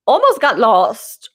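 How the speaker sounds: ordinary delivery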